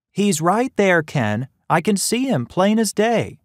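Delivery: frightened insistence. Acted. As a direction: insist in alarm that the man is real. Mom insists she sees a man.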